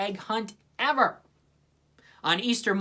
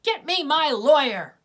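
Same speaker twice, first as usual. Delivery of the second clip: high, loud outraged demand; fuming demand; 9 semitones higher, 8 dB louder than usual. A narrator's reading. Sally outraged at Linus, loud and high. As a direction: shout for your lawyer. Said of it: "she fumed".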